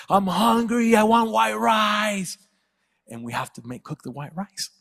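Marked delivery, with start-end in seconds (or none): 0.1-2.4 put-on high, drawn-out child's wail; whining hungry son. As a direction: whine loudly like a demanding little boy. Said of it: "when he's like".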